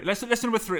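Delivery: usual speaking voice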